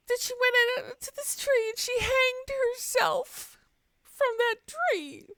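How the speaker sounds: high, hammed-up weeping voice of a grieving neighbor; a neighbor crying over a tree, mock sobbing high voice. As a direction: squeeze out a tearful high wail as the bereaved man. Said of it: "He's just standing there sobbing".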